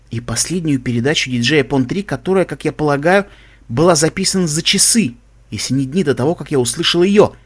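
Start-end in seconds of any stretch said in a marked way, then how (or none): none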